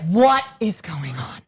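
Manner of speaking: slow, drawn-out diva demand; mother impression. Mom coming over to them, slow theatrical diva. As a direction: stretch each word like a dramatic mom. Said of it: "she'd come over, she'd be like".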